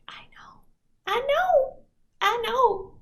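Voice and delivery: high, far-off cooing; cooing reassurance. Retold or imitated. Marissa reassures a needy client, high cooing. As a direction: coo it like a soothing mother.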